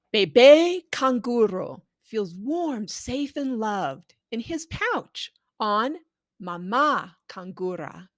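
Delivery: slow, gentle storybook read-aloud; reading to children. Acted. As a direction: read it like a bedtime story to a toddler. Reading the picture book aloud.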